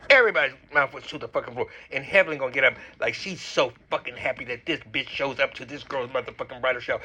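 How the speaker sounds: ordinary delivery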